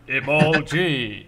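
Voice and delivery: slow hammed-up hero shout of a name; Ginyu Force pose call. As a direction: strike the pose and drawl the name out big. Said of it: "I wanna do, like, a Ginyu Force".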